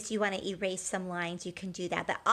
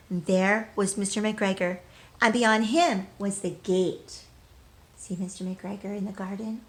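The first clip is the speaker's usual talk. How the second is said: bright, lively storybook read-aloud; reading to children. Acted. Teacher reading a picture book to children, lively.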